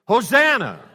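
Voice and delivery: high loud Hosanna to the congregation; bright loud call-and-response lead; leader's call. Shown as a demonstration. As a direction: call it out for them to echo. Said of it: "I'll say it, you repeat it".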